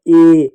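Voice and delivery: "ए" held long and loud, a steady "ay"; loud, drawn-out "ए"; demonstrated vowel. Shown as a demonstration. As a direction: sustain "ए" clearly for pupils. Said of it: "ए के रूप में होता है".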